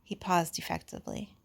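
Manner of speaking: ordinary delivery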